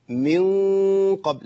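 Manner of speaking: long hum in من قبل, tongue tip near the n position; tongue near noon. Shown as a demonstration. Teacher showing tongue placement, long hummed noon. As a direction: stretch من into a nasal hum before قبل. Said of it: "قرب مخرج النون".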